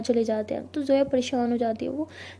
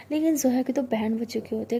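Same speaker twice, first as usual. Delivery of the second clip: ordinary delivery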